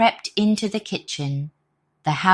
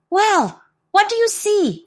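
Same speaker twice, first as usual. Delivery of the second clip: much higher, louder impatient demand; barked; 5 dB louder than usual. Acted. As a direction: bark the question like an eager dog. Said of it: "the dog barked".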